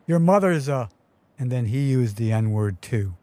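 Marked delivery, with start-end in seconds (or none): 0.0-0.9 higher-pitched narrated yell-back; mild mock yell. A narrator's reading. Larry yelling back at the passing car, a restrained narrated yell. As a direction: yell back, but held in to storytelling volume. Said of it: "cupped his hands and yelled back".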